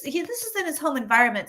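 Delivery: ordinary talk, nothing marked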